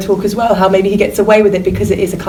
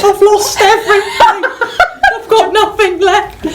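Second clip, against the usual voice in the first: high, laughing, wailing EastEnders whine; hammed-up Ian Beale, laughing through it; 12 semitones higher than usual. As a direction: wail it high like a soap star. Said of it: "Ian Beale".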